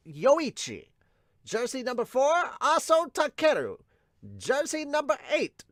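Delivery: high-pitched stadium roster call; announcer voice. Acted. Host calling out the team's jersey numbers, announcer voice. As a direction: announce each name to the crowd.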